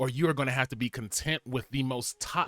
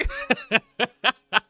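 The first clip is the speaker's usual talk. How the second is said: laugh; 9 semitones higher, 3 dB louder than usual. Real, not acted. Laughs at own joke.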